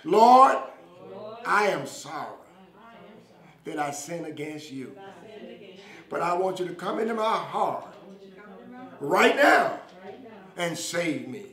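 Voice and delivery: slow short phrases with long pauses for repetition; leading a prayer. Real, not acted. Preacher has viewers repeat a prayer for salvation, slow and fervent.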